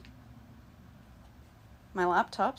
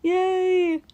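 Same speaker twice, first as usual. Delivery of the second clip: high, loud excited greeting; 11 semitones higher, 8 dB louder, slower than usual. Real, not acted. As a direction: squeal hello to a friend. Greeting a friend joining the stream.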